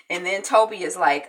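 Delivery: usual speaking voice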